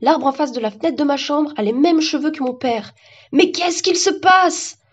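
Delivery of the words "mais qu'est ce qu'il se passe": high, drawn-out bewildered cry; panicked, slow high-pitched question; alarmed cry. Acted. Alarmed boy sees strange trees.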